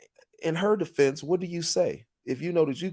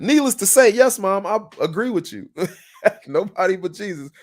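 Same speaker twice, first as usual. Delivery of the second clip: ordinary talk, nothing marked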